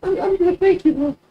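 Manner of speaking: hesitant high-pitched refusal; politely declining girl. Acted. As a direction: back off gently in a small falsetto.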